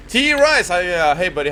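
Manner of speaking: bright loud hello to a donor; excited greeting. Real, not acted. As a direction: call out hi to a friend.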